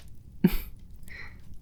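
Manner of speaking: a quiet, airy demon laugh; soft breathy chuckle. Acted. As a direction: chuckle under your breath, amused.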